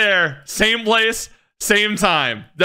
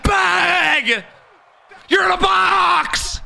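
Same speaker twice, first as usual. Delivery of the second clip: hype shouting; 12 semitones higher, much slower than usual. Real, not acted. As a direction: celebrate the big play. Hype shouting after play.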